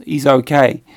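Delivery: ordinary talk, nothing marked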